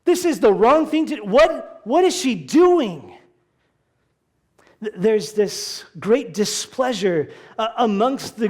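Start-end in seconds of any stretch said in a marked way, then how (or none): 0.0-3.1 higher, louder, exasperated complaint; grumbling disciples voiced with higher, louder indignation; mock indignant outburst. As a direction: act out the disciples' outrage, a bit hammed up. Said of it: "they're somewhat angry even".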